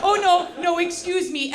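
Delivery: usual speaking voice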